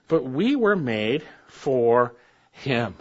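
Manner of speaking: slow, deliberate; slow emphasis. Acted. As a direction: let each word land slowly. Preacher slows for emphasis.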